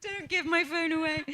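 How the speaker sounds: laughing. Real, not acted. Jokes about phone taken.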